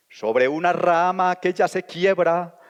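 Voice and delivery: slow, lofty recited verse; grand poetry declamation. Shown as a demonstration. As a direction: declaim the stanza slowly and grandly. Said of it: "le sacó el siguiente, la siguiente estrofa".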